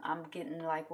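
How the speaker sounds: ordinary talk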